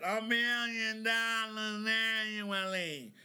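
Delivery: "A million dollars annually" powoli, każde słowo z naciskiem; slow, drawn-out stress on "million"; slow emphatic repeat. Shown as a demonstration. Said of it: "So let me repeat that".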